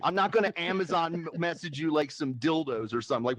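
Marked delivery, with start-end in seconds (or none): none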